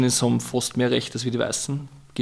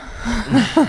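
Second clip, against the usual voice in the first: kurzes, hohes, lautes Lachen; hohes Auflachen; 11 semitones higher, 4 dB louder than usual. Real, not acted. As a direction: lacht hell und laut auf. Laughs at question. (hohes Lachen)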